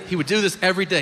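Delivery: normal talking voice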